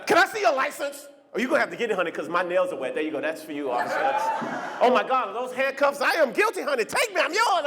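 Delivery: high, camp and flirty. Retold or imitated. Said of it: "start flaming on him".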